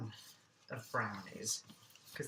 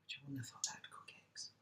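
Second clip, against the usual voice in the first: whispered aside; 14 dB quieter, faster than usual. Real, not acted. Aside while cooking.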